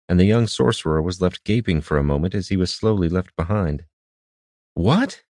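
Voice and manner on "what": startled what. Acted. Left behind, gaping in surprise.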